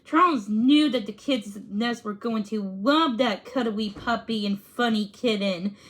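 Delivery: ordinary delivery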